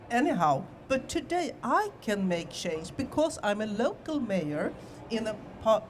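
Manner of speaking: ordinary delivery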